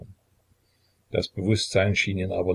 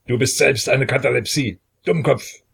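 louder, higher scornful snap at the doctor; angry interruption; 5 semitones higher, 5 dB louder than usual. A narrator's reading. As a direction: cut him off with contempt. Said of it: "unterbrach ihn Peter".

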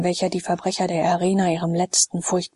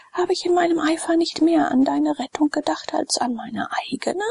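put-on higher, impassioned pleading with the young man; fervent preacher; 9 semitones higher than usual. Olinthus in the cell, urging Glaukus to faith, fervent. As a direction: urge him to the one God with zeal. Olinthus exhorting his cellmate.

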